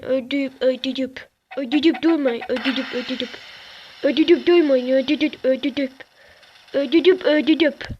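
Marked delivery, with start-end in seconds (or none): 1.6-7.9 put-on babyish mumble of ödüdüp durmayın; baby talk. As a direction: lisp the words like a toddler. Babyish mangled repetition. (bebek gibi peltek ödüdüp)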